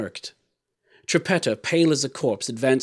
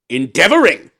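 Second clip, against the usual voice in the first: loud, higher, outraged echo; fierce cry; 5 semitones higher, 11 dB louder than usual. A narrator's reading. Tyrant scorning the dwarf's answer, fierce. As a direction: throw the word back fiercely. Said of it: "cried the tyrant fiercely".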